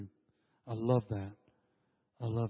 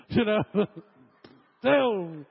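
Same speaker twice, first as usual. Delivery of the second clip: laughs through the words, louder; laughing; 5 semitones higher, 4 dB louder, much slower than usual. Real, not acted. Preacher to congregation, laughing at his gray hair joke.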